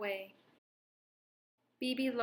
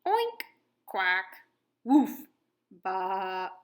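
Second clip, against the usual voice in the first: animal noises; 3 semitones lower, 6 dB louder, much slower than usual. A narrator's reading. Other animals join in.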